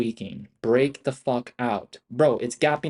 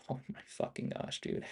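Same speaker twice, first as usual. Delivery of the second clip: whispered; 16 dB quieter than usual. Real, not acted. Exasperated aside under breath.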